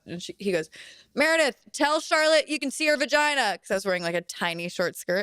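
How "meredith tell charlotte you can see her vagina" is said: a dad calling out across the set, higher; mock shout. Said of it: "my dad shouted out".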